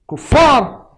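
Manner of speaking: high shouted accusation, hammed up; mock outrage, loud and high; mock outraged cry. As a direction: bark it out like a smug zealot. Mock preacher outburst.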